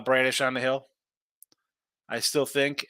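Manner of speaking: normal talking voice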